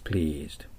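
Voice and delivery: "Pleased" with a drawn-out ee; long "ee" held in "Pleased"; long vowel. Shown as a demonstration. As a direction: stretch the vowel in "Pleased", low and soft. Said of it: "喜ばせるだけ伸びます".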